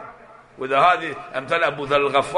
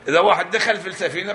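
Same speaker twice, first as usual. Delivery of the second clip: ordinary talk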